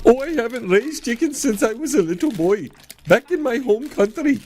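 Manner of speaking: lightly voiced high-pitched clown. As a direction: put on a silly high clown voice. Clown character answers, confused.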